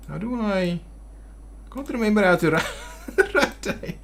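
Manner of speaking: giggling at his own forgetfulness; breathy laugh breaking the word. Real, not acted. Laughing at himself mid-sentence.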